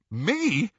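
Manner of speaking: high, startled question; shocked. A narrator's reading. Ron to Harry on being handed the task, shocked. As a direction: blurt it out, taken aback. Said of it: "looking shocked".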